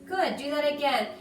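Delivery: normal talking voice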